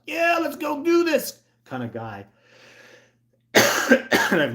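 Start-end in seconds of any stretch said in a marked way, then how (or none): 0.1-1.4 high, loud, slow hyped-up yell; over-the-top thrill-seeker shout; stoked. Retold or imitated. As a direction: pump yourself up like a daredevil. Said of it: "stoked kinda".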